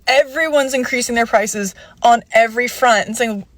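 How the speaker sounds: ordinary talk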